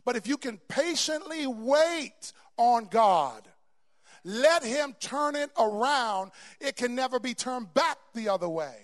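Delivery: loud, high, slow preaching build; fiery sermon climax; preaching at full force. Real, not acted. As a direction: preach it out to the back row.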